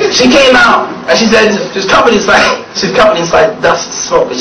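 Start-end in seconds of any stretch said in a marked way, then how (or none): none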